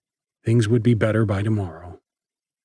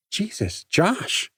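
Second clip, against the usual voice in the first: startled; 9 semitones higher, 3 dB quieter, slower than usual. Acted. Startled by Josh kicking fire.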